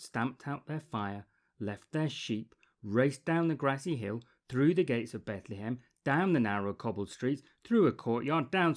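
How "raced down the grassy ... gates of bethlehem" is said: ordinary delivery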